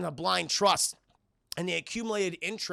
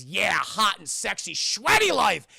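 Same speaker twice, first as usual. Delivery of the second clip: hammed-up lusty excitement; 2 semitones higher, 5 dB louder, slower than usual. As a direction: imitate an over-eager lover. Lusty character reply acted out.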